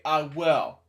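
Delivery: ordinary delivery, nothing marked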